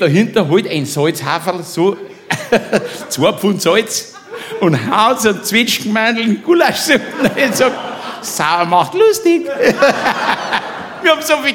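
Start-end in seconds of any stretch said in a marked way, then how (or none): 3.1-11.5 laughing. Real, not acted. Laughing through punchline.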